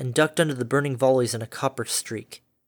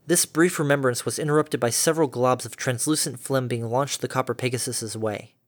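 plain reading voice

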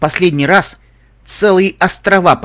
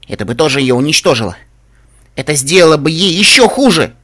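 hard words rising to a loud finish; firm, sharp; 4 semitones higher, 7 dB louder than usual. A narrator's reading. Heroine arguing it would hurt her worse, firm and sharp. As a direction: drive it home, louder and higher. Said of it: "Мои слова были тверды и остры, словно нож".

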